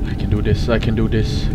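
low, soft mantra; quietly steady your nerves, pitched low; hushed pep talk to yourself; self-encouragement. Real, not acted. Psyching self up.

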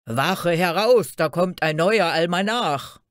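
loud guard calling out the watch; rough shouted alarm. A narrator's reading. Sentry alerts the watch to a newcomer, rough shout. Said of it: "rief da eine rauhe Stimme".

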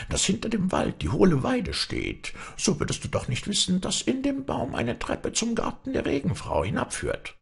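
high, squeaky goblin. A narrator's reading. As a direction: pitch up into a tricky little kobold. Kobold voice in fairy tale.

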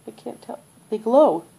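usual speaking voice

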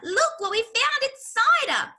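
high, loud squeal of discovery; excited cry. A narrator's reading. Smallest fox showing Dad the jars, crying out. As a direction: shout the discovery at high pitch. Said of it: "he cried out".

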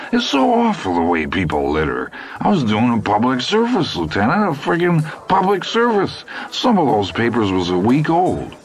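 high, indignant, self-important whine; righteous Hap. A narrator's reading. Hap boasting to Bozeman of his public service, indignant and high. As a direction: puff up about your public service.

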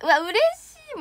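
high, loud burst of joy; delighted. Real, not acted. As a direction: squeal it out, overjoyed. Delighted by a fan comment. (大喜びで高く叫ぶ)

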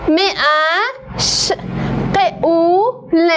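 م ا ش ق او ل, each sound said apart with pauses. Shown as a demonstration. Teacher sounding out مشغول letter by letter, slow. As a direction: spell out the sounds one by one.

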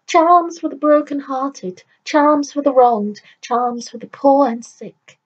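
loud, high calling out to passers-by; old crone hollering charms to the crowd. Acted.

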